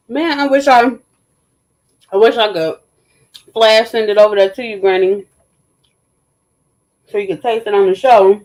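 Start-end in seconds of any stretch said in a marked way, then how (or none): none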